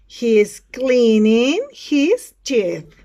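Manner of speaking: very slow, loud and drawn out; word-by-word model sentence. Shown as a demonstration. Teacher repeats for students, very slow word-by-word delivery. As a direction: stretch each word at full classroom volume. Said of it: "Repeat after me".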